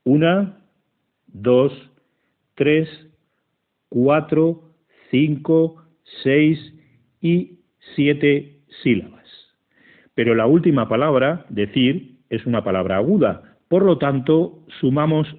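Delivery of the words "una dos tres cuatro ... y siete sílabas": each number set apart by a pause, slow and deliberate; counting syllables aloud. Shown as a demonstration. Teacher counting the verse's syllables for students, slow and deliberate. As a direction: tick off every syllable one by one.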